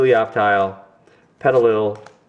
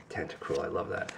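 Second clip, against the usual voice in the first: hushed; 9 semitones lower, 17 dB quieter than usual. Real, not acted. Sudden hushed repeat.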